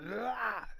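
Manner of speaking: high-pitched drawn-out "ah" yell; fighter's yell. A narrator's reading. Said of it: "Sendo yells out".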